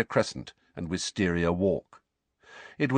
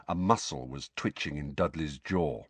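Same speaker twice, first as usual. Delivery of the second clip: usual speaking voice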